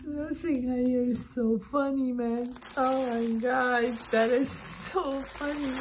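high voice after a laughing fit; weak with laughter. Real, not acted. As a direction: wail it, helpless with amusement.

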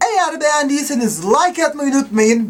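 loud, upbeat sign-off to viewers; youtuber outro. Acted. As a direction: sell the like button with energy. Cierre del vídeo.

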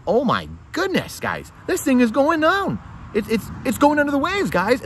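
high, loud, slowed-down mock panic; hammed-up alarmed cry. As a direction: act out someone realizing the ship is sinking. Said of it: "moving away from the fiat ... saying".

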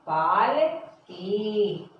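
पालकी stretched out long; very slow model. Shown as a demonstration. Teacher repeating पालकी very slowly. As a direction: hold पालकी for a long beat. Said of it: "क्या बोलते हैं".

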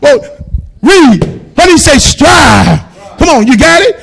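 loud shouted preaching; preaching at full force. Acted. Pastor shouting to the congregation at full force. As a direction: drive it home to the church.

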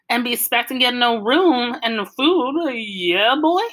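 mock Southern hillbilly accent for laughs. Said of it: "Dad did an imitation of a hillbilly accent".

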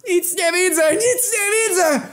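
very high-pitched wailing scream; panicked screaming. Acted. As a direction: scream as if suddenly blinded. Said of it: "padać na ziemię i krzyczeć".

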